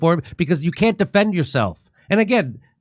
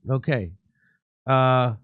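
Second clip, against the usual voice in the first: ordinary delivery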